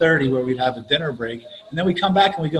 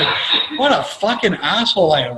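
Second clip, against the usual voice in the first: ordinary talk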